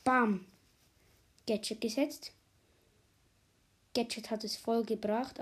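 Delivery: ordinary delivery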